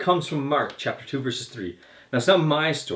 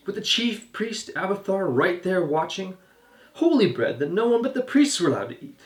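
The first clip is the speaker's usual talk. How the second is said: higher-pitched, challenging questioning; incredulous retort; 6 semitones higher than usual. A narrator's reading. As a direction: throw the question back at the accusers in disbelief. Jesus retorts cleverly to his accusers.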